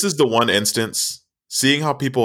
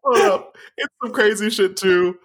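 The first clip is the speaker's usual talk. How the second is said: high excited; 10 semitones higher than usual. Real, not acted. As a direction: jump in high and hyped. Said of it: "excited interjection".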